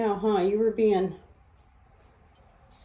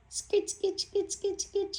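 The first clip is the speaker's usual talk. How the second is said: high chirpy call; 11 semitones higher, 6 dB quieter, slower than usual. Real, not acted. Calling bird with sounds.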